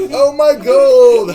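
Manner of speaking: high, loud, slow anguished cry; greedy wail. Acted. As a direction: howl over lost gold. GM mocks a greedy NPC.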